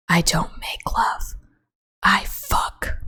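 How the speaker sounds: slow, whispered movie line; mock seductive whisper. Quoting a lover's line she finds sickening, mock seductive whisper. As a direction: whisper it slowly, as a hammed-up seduction.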